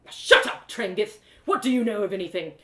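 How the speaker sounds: loud, furious retort; angry outburst. Acted. Theron snapping angrily at Trengith. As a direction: spit it at him in rage. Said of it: "stormed off".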